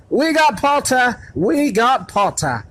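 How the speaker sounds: high, loud gleeful yell; twins yelling. A narrator's reading. The Weasley twins cheer Harry's sorting, yelling. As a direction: crow it across the hall. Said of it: "the Weasley twins yelled".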